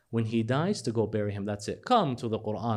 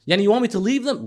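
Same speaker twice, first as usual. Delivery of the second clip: shocked disbelief; 8 semitones higher, 8 dB louder, faster than usual. Acted. Ibrahim voiced protesting God's command.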